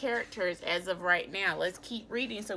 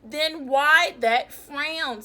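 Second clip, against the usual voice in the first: slow, louder teasing question; questioning snake; 5 semitones higher, 9 dB louder, slower than usual. Acted. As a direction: draw it out like a sly snake.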